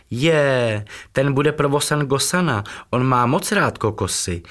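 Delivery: high, bright-voiced little hen calling out with delight; excited calling. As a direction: squeal it with joy at the gift. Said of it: "volala nadšeně vaječnatka".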